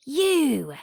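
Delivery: a high, loud shout of "You"; scream. Acted. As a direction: hurl the word at her in rage. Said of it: "she screams".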